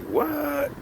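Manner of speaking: mock-baffled, pitch shooting way up; a stunned "what?", half-mocking; incredulous what. Incredulous reaction to news claims.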